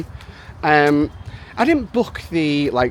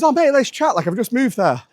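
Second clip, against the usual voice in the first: excited quote; 8 semitones higher than usual. Retold or imitated. Excited guy quoted in story.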